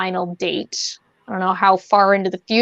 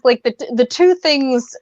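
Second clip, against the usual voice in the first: ordinary delivery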